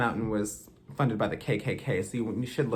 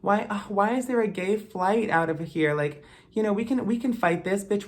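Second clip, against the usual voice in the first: high, whiny mock of the bystanders; mocking imitation; 9 semitones higher than usual. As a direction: parody people who sat by and now act shocked. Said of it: "you're all gonna be saying".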